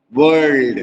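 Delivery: वर्ल्ड with a hard rolled Irish r and l. Shown as a demonstration. Teacher demonstrating Irish accent to class. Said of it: "hardly pronounce करते हैं, जैसे".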